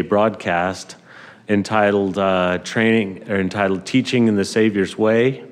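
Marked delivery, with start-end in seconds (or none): none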